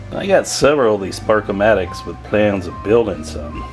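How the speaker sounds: ordinary talk, nothing marked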